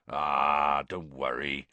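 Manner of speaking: put-on a big man comforting a girl gently; gentle Hagrid. A narrator's reading. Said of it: "said Hagrid gently".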